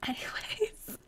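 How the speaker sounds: soft whispered aside; sheepish whispered anyways. Real, not acted. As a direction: breathe it under the breath. Whispered aside after fumble.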